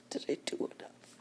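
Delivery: ordinary delivery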